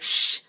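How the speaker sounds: long loud shh, straight into the ear; loud shush right into the ear. Shown as a demonstration. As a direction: shush like an angry librarian. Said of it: "angry librarian type sound".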